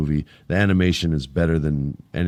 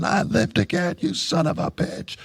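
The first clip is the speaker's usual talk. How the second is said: action-hero taunt; 12 semitones higher than usual. Retold or imitated. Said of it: "and he's laughing".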